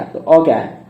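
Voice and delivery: ordinary delivery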